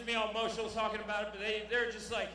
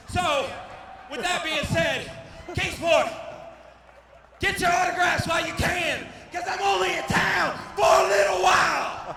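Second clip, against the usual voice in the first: loud, higher shout to the crowd; loud promo close; 6 semitones higher, 7 dB louder than usual. Real, not acted. Fighter shouting his sign-off to the hometown crowd. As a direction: sign off with a boast.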